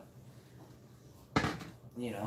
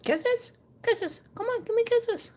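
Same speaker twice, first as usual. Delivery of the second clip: squeaky, very high coaxing voice; 3 dB louder than usual. Real, not acted. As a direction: beg the kitty for kisses. Asking cat for kisses.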